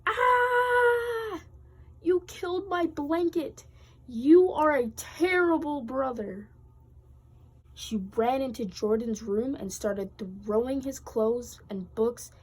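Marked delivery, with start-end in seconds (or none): 0.1-6.5 very high, loud screaming accusation; loudest yell. Acted. Taylor yelling at Jordan, loudest scream. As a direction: scream with everything. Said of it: "the loudest yell possible".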